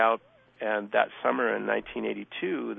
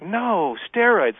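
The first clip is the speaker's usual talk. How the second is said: retold insistence; 8 semitones higher, 7 dB louder, much slower than usual. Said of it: "they're like".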